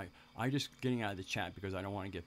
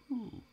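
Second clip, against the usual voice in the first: high, short "ooh" of piqued interest; a little high-pitched hoot of interest; intrigued ooh. Retold or imitated. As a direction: imitate your own high-pitched ooh of discovery. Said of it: "I went".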